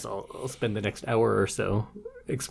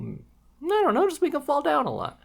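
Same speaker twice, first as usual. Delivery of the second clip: a much higher, silly voice for a clueless writer; mocking male writer. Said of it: "they're like".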